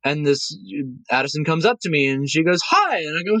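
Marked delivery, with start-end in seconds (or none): 2.7-3.0 put-on high, small child's hello; a little girl's high, shy hi. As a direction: chirp it like a shy kid. Little girl greets performer.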